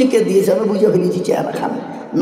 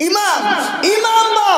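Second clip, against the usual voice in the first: high-pitched call; 9 semitones higher, much slower than usual. Real, not acted. Preacher introducing the imam's story, calling out. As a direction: hail the imam up high. Calls out the imam as topic.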